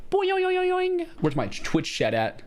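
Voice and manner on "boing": squeaky cartoon boing; high-pitched springy boing. Playful sound effect.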